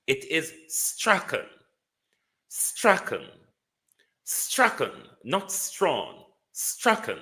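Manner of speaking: "Strachan" hoch und nachdrücklich als Strakan, mit deutlichem k; Strachan, not Strong. Shown as a demonstration. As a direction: betone "Strachan" als Stra-kan, lauter und höher. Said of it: "is the pronunciation".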